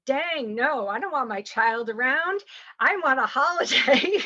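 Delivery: building mock protest, breaking into a laugh at the end; playful quoted refusal. Agency host quoting a surrogate who wants a break, playful mock protest.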